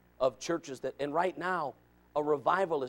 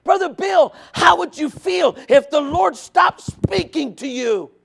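high, loud pulpit appeal; preacher pleading at full voice; full-force preaching; 11 semitones higher, 11 dB louder than usual. Real, not acted. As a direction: press the question on the congregation, loud and high.

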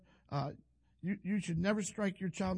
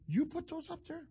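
father asking, pitched much higher; a high, incredulous dad voice; dad's voice; 10 semitones higher than usual. Retold or imitated. Retelling his dad's disbelief at the clock stickers, voiced high.

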